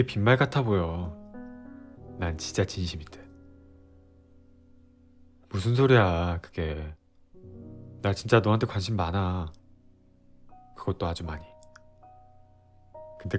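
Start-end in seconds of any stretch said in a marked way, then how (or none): 2.2-11.4 sincere. Acted. Boyfriend pleading sincerely.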